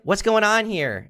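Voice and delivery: high, drawn-out mock demand; imitated indignant girlfriend. Girlfriend comes over to confront him, mock indignation. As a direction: lift it high and slow, like an affronted woman.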